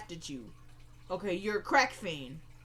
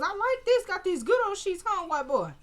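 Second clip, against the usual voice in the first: high, loud, teasing put-on voice; imitated woman; 10 semitones higher, 3 dB louder than usual. Woman admiring her captive's bed, high teasing imitation. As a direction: start way up high and come down.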